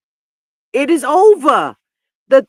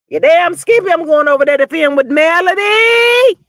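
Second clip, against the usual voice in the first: mock proud shout; 5 semitones higher, 7 dB louder than usual. Voicing proud reply, shouting.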